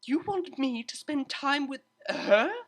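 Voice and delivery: high, slow, indignant disbelief; aghast at the very idea; exclaimed. A narrator's reading. Octavia protesting to the tutor, high indignant exclaim. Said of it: "exclaimed Octavia".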